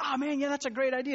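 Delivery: high, too-agreeable brush-off; feigned enthusiasm. Retold or imitated. Agreeing to a stranger's ride-share request, falsely enthusiastic. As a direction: put on eager agreement.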